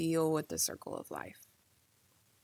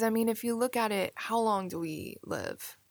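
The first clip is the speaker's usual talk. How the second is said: ordinary talk, nothing marked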